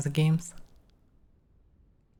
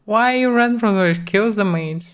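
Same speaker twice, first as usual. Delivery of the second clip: raised, urgent call to the player; urgent shout; 5 semitones higher, 4 dB louder than usual. Real, not acted. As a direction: shout it at him to turn back. Urging player to attack mage.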